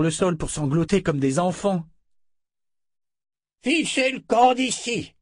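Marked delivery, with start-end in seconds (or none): 3.6-5.1 shouted order. Acted. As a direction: drive them off with a shout. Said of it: "cria Zed".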